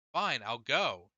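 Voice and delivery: slow, pressed yell held back in volume; fed up, spitting it out slowly without shouting; yelled, not loudly. A narrator's reading. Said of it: "he yelled, not loudly".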